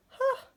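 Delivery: high, loud indignant huh; hammed-up scoff; huffy snort. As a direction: scoff in a burst.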